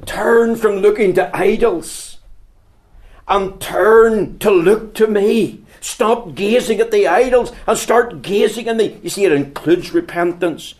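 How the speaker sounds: loud, high, slowing call to turn; preaching at full force. Acted. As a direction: plead with the hearers to turn and look. Preacher's closing appeal.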